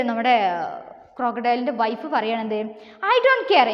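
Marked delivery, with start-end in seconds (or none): 3.1-3.7 high, loud storybook retort; mock defiance as the wife; acted protest. Wife quarrels with crocodile. (ഉയർന്ന സ്വരത്തിൽ ഭാര്യയുടെ പരിഭവം അഭിനയിച്ച്)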